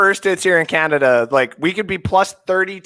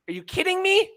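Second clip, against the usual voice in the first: incredulous; 11 semitones higher, 6 dB quieter than usual. Real, not acted. Ranting about Madden.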